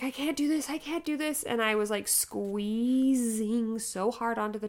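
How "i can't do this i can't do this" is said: high, thin mock plea to the nurse; retold despair. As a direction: plead that you're at your limit, acted.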